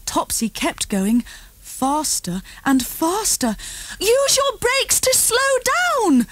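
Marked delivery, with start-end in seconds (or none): 4.0-6.3 much higher, shouted warning. A narrator's reading. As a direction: call out sharply to the child to brake. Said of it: "shouted Mummy".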